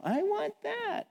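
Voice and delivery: put-on high, slow whine of a four-year-old; whiny child. Preacher mimics a toddler begging at the checkout in a whine. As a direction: drag out a kid's begging whine.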